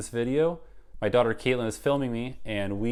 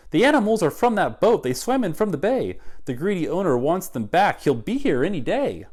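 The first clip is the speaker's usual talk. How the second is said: higher, louder urgent report; breathless messenger news; 8 semitones higher, 8 dB louder than usual. Acted.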